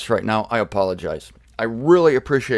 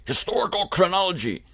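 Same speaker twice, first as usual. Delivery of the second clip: hammed-up German accent, higher pitch; mock German delivery, pitched up; Bader; 4 semitones higher, 4 dB quieter than usual. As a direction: imitate a stern German scholar. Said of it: "Bader would say".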